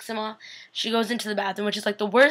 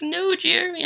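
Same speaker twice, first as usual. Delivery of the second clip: dismayed cry; 9 semitones higher than usual. Retold or imitated. Reliving own reaction.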